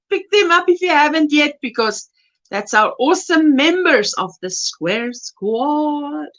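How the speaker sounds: ordinary delivery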